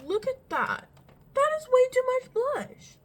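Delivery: high loud dismay; shocked. Real, not acted. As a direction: cry out at the mirror. Shocked at makeup.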